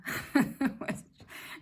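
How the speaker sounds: high, loud burst of laughter; amused laugh. Real, not acted. Laughing about tears taking off all the mascara, amused.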